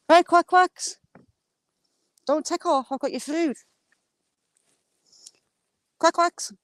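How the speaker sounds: calling the ducks. Real, not acted. Calling ducks to come eat.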